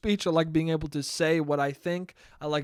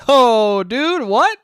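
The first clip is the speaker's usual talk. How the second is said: surprised; 7 semitones higher, 10 dB louder, much slower than usual. Real, not acted. Reacting to Canada stipend news.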